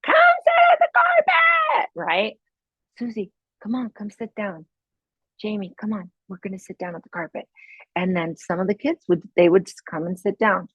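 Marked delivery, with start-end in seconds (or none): 0.0-1.9 high, loud teacher shout; yelling at the class instead of whispering. Retold or imitated. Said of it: "instead of saying". 3.0-7.5 put-on soft, gentle coaxing of the children; teacher quietly gathering her class. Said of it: "I would actually get quieter".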